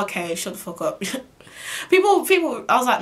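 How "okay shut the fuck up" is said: low, soft dismissive mutter; quiet scornful throwaway; muttered aside. Real, not acted. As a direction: say it under your breath to yourself. Disbelieving his excuse.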